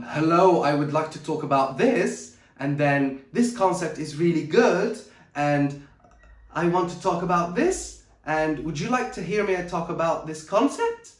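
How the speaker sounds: every phrase ends on a rising tune, like a question; uptalk demo. Shown as a demonstration. Coach showing viewers annoying uptalk, rising question tune. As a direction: end each clause going up, unsure of yourself. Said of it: "Uptalk is like when you say".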